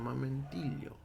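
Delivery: ordinary talk, nothing marked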